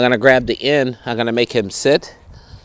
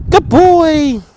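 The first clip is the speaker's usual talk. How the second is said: praising the dog; 14 semitones higher than usual. Real, not acted. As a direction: lift the voice high to reward him.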